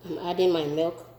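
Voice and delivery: ordinary talk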